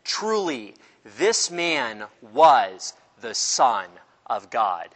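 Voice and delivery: drawn-out, very slow solemn words; slow solemn confession. Shown as a demonstration. Centurion at the cross confessing, very slow and solemn. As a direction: let the confession land slowly. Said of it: "he said".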